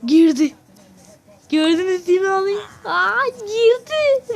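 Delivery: high, loud amazed cries, drawn out at the end; excited disbelief. Real, not acted. Gamer showing viewers the villager sinking into a block, excited disbelief. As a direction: exclaim like you can't believe your eyes.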